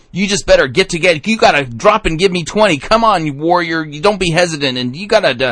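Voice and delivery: fast, loud, high drill-sergeant bark; barking gym coach. Retold or imitated. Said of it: "or we're hearing".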